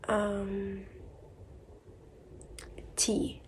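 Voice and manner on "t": ordinary talk, nothing marked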